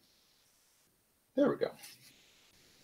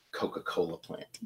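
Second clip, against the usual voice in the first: Coca-Cola whispered and much softer, caught using a brand name; sheepish whisper; 5 semitones lower, 9 dB quieter than usual. Real, not acted. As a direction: drop to a guilty whisper on Coca-Cola plant. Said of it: "should not be using brand names".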